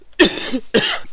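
harsh dry coughs; coughing fit. Real, not acted. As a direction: cough hard, unable to stop. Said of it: "Pardon, je tousse".

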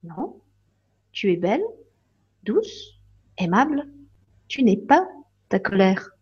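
soft, hushed and tender; gentle fairy. Acted. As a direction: reassure her softly, lifting each word. Fairy reassures softly.

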